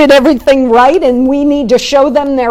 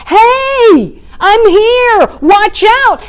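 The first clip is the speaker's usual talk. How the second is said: much higher, loud frantic warning yell; louder scream; 10 semitones higher, 6 dB louder, much slower than usual. Retold or imitated. Boater screaming at the boat about to hit him, frantic high yell. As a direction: scream it even louder in alarm.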